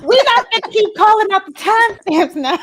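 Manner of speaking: loud, high, amused objection; laughing protest. Real, not acted. Host objecting to co-host's timestamp joke, laughing protest. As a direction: protest loudly while cracking up.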